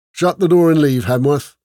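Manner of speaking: loud, growled lordly order; rough growl. A narrator's reading. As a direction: growl the dismissal roughly at the steward. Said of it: "the voice growled, deep and rough".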